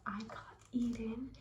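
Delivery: hushed secret whisper. Real, not acted. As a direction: whisper it so nobody hears. Secret Santa reveal to camera.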